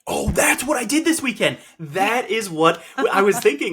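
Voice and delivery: high, loud burst of realization; suddenly remember and blurt it out; excited realization. Real, not acted. Sudden realization laughing at herself.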